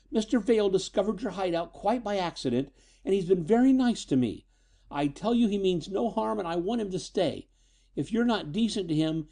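put-on high-pitched, insistent girl; defiant. Daughter threatens to leave with the visitor, defiant. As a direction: lay down the ultimatum in a high, heated voice. Daughter defies father in quarrel.

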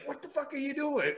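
high, incredulous quoted question; retold shocked outcry. Retold shocked reaction to a slap.

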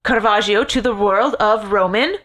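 ordinary talk, nothing marked

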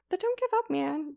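squeaky encouraging cheer; high pep talk. Acted. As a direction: cheer him on brightly. Cheering Ichabod on.

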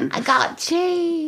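slow, high, laughing mock announcement. Jokey guest reading out the cheese T-shirt, laughing. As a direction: drawl it out through a laugh.

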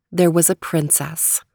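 usual speaking voice

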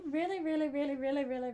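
laughing. Real, not acted. Mocking the review, still laughing.

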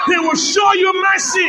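high, held-up preacher's declaration; building proclamation. Real, not acted. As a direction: lift the promise over the congregation. Repeat built up for congregation.

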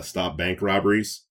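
usual speaking voice

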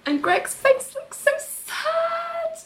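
very high, drawn-out squeal of pity; mock heartbroken. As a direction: stretch it out in a tiny sad voice. Mock heartbreak over Greg's sad face.

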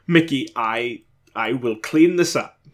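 a British ghost tripping over his apology; embarrassed stammer in a British accent; flustered British accent. Acted. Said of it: "His accent stuttered with embarrassment".

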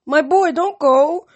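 high, slow, loud plea; drawn-out pleading mother's call; calling after him. A narrator's reading. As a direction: call after your departing son. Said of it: "called after him".

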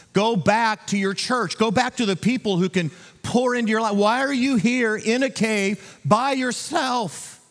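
high, urgent pulpit exhortation; impassioned preaching. Real, not acted. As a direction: drive the plea home, pitched high and insistent. Preacher exhorts at full force.

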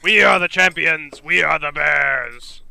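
mildly shouted champion's boast; yelled boast. Acted. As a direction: declare it to the whole arena. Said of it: "And I yell".

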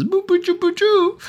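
put-on high cooing baby talk. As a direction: coo like at an infant.

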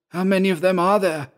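high, eager character voice; high-pitched eager question; eager Georg. A narrator's reading. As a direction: ask urgently, pitched well up. Georg asks eagerly about rescuers.

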